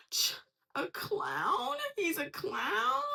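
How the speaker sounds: very high, stretched-out rising CLOWN; incredulous squeal, drag the word out; mock-offended disbelief. Repeating the insulted person's reaction about a clown, mock disbelief.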